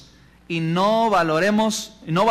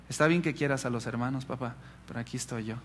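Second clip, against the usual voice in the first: very soft, low, gentle reminder; retold tender child; 5 semitones lower, 9 dB quieter, faster than usual. Son reminding father he is here, soft tenderness. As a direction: let it sink to a tender near-murmur. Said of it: "Él me dijo".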